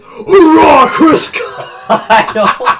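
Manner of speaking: high, loud soldier's cheer; loud gung-ho soldier. Retold or imitated. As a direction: shout it out like a proud Marine. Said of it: "the human meme stood up and he goes".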